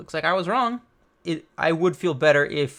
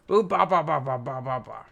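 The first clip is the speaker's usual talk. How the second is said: giant's booming laugh. Acted. Giant's laugh voiced in reading.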